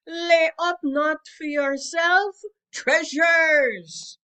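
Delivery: loud, slow, high taunting call; mocking shout. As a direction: preach it at the miser with a shout. Sophia taunting the miser.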